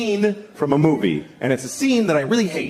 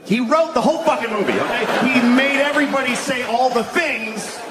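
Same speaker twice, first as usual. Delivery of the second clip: exasperated; 7 semitones higher than usual. Real, not acted. Comic ranting about the scene.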